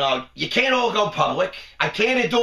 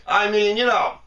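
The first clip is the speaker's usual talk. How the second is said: usual speaking voice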